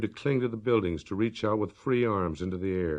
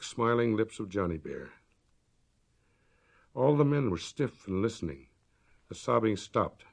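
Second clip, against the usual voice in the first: ordinary delivery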